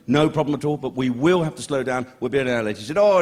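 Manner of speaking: ordinary talk, nothing marked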